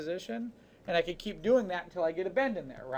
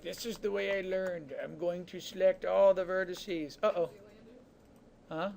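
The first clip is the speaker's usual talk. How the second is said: mocking an old-school Maya user as Zoolander; Zoolander impression. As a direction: drag out the words like a vain, clueless model. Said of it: "Does that sound like Zoolander?".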